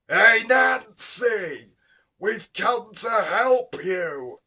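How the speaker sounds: very high, loud, slow cheery shout; jolly Santa. A narrator's reading. Santa calls out to his wife, jolly and cheery. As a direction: boom a jolly hello across the room. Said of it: "a jolly and cheery voice".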